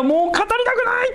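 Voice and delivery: a sudden high, strained cry of a weary prophet; Jeremiah's lament. Retold or imitated. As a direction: cry it out like a prophet at the end of his strength. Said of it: "ってこう言ったんです".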